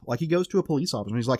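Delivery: ordinary talk, nothing marked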